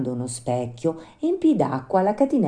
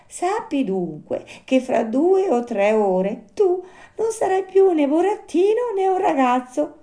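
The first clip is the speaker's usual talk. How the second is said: grave little marmot; 6 dB louder than usual. Acted. Narrator voices the marmot explaining.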